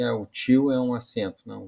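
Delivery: normal talking voice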